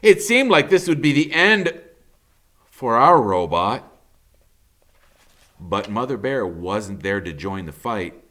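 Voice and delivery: ordinary delivery, nothing marked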